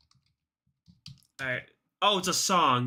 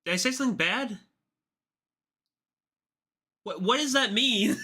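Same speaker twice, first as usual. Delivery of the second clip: pitched up and louder, suddenly worried; alarmed surprise; 8 semitones higher, 4 dB louder than usual. Real, not acted. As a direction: ask like you fear you said something bad. Worries a word was offensive.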